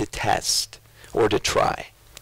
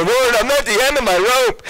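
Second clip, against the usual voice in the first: mock despairing cry; 8 semitones higher, 3 dB louder than usual. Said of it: "you know".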